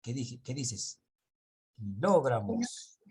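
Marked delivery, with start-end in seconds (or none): 1.8-3.0 slow "kilogramos" with a strong LO; stress on LO. Shown as a demonstration. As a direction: stretch "kilogramos", hitting the second syllable, lo. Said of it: "En el caso que tú dijiste".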